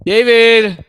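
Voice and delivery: loud, high call of his name. Real, not acted. Host loudly greeting David back on the stream, calling out. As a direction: hail him as he returns.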